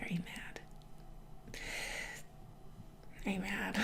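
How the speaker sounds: timid unvoiced whisper. Whispering to James as if he might be mad. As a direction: breathe it like a nervous secret. Imagined question to returning James.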